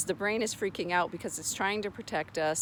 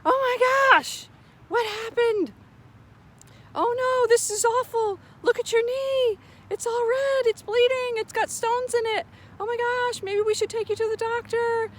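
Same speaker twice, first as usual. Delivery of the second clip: high, loud, frantic alarm over a scraped knee; panicked mom; 7 dB louder than usual. Pretend. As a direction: overreact like a terrified parent, much higher and louder. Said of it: "I can be like".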